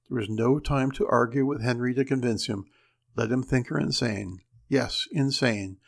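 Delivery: plain reading voice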